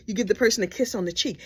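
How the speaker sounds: normal talking voice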